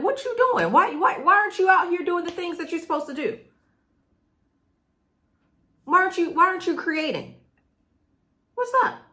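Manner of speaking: high-pitched, incredulous pestering; exasperated disbelief. Retold or imitated. Coach looking at clients not creating, high exasperated disbelief. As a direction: demand answers in a high, baffled voice.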